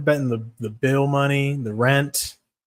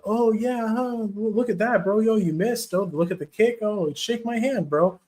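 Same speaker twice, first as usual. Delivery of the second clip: mocking Silva's taunts; 9 semitones higher than usual. Fighter mocking his opponent with handshakes, high and teasing. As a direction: taunt the opponent with a friendly sing-song. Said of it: "all the handshake stuff".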